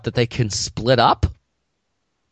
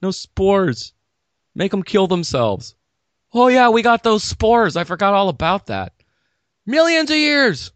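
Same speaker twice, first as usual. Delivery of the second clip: high-pitched comic tree with sudden revelations; hammed-up, high talking tree; exasperated tree; 7 semitones higher than usual. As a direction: play a tree excitedly remembering its spores. Comic monologue voicing a tree.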